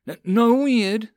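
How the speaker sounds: high, stammering yelp; nervous yelp. Acted. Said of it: "the man yelped".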